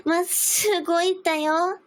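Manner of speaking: high cutesy anime voice; VTuber voice. Pretend. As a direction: squeal it like a VTuber. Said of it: "cute voice set against plain".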